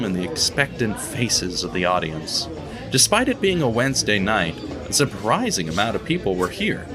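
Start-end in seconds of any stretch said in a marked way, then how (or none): none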